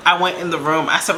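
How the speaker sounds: ordinary talk, nothing marked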